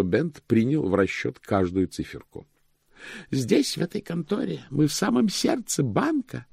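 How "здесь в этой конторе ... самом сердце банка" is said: high-pitched, proud chief cashier; proud declaration in a raised voice. A narrator's reading. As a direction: show off the heart of the bank. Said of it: "произнёс старший кассир с гордостью".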